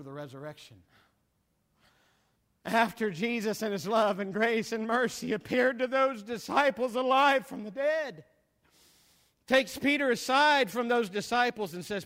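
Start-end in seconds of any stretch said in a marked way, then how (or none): 2.6-11.7 loud, soaring preacher's cadence; preaching at full force. Acted. Preacher rousing his congregation, full force preaching. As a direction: preach it to the back pew.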